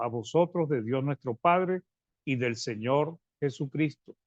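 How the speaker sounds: ordinary talk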